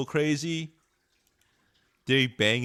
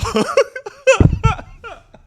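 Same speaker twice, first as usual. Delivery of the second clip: high burst of real laughter; sudden explosive laugh; loud laugh; 12 dB louder than usual. Real, not acted. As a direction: crack up out loud at the line. Bursts out at the lyric.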